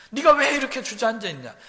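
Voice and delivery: preacher voicing the psalmist pinching himself; self-rebuke. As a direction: snap at yourself for sitting slumped. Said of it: "자기를 자기가 꼬집습니다".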